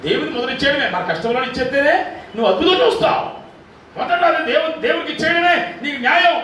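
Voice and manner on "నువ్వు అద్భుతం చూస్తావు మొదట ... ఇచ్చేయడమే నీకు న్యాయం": preaching shout. Real, not acted. Pastor exhorting congregation on tithing.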